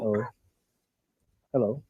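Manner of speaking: faint, barely heard on the line; a weak hello, far from the phone; too quiet. Real, not acted. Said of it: "Can you speak louder".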